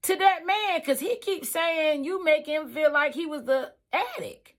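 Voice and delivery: confronting coworker. Retold or imitated. Questioning coworker about the handyman, high and pressing. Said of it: "and I said".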